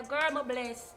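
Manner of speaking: usual speaking voice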